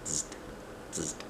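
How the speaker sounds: soft electric zzzt buzz; a quiet playful zap noise; sparky sound. A narrator's reading. Jim pokes Junie B. with a soft sparky buzz. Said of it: "he made a sparky sound".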